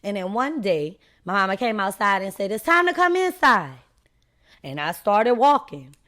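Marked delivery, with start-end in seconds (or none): 2.5-3.8 mama's high-pitched call. Retold or imitated. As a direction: call your child indoors, pitched up high. Her mama calls her inside.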